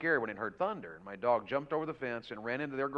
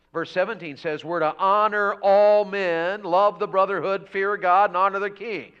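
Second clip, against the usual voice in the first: raised, loud proclamation of the verse; emphatic scripture preaching; 6 semitones higher, 11 dB louder than usual. Real, not acted. Preacher citing verse seventeen to the church, emphatic proclamation. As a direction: hammer out each command.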